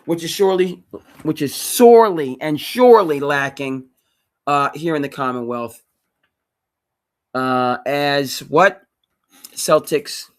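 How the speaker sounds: loud, slow, emphatic declaration; booming sports-radio fury; fired-up rant. Real, not acted. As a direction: hammer it home with outrage. Passionate rant peaks.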